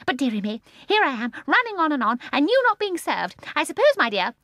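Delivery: put-on high, loud, gleeful old woman; gleeful old lady. A narrator's reading. Mrs Corrie answering Michael, high gleeful old woman. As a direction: chirp it with glee.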